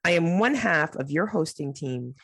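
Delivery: ordinary talk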